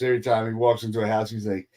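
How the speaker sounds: normal talking voice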